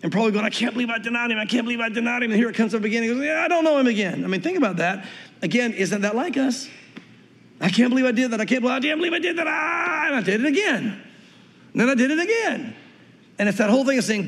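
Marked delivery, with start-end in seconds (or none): 0.5-2.3 mildly distressed, slightly softened self-talk; anguished self-reproach. Acted. Peter replays his denial to himself, anguished self-reproach. 3.2-4.2 higher-pitched, hasty brush-off; exasperated denial. Acted. Peter denies knowing him once more, exasperated. 7.6-12.7 high, hammed-up wail of regret; anguished self-reproach. Believer laments sinning once more, anguished self-reproach.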